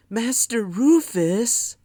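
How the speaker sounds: high, drawn-out sugary tattletale whine; sweetest tattletale voice. A narrator's reading. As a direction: sweet-talk the teacher like a tattletale. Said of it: "in his sweetest tattletale voice".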